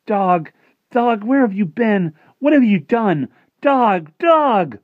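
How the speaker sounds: very high, loud cries of joy; overjoyed shouting. Acted. Martin greets his returned dog, shouting with joy. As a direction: shout the dog's name in wild relief.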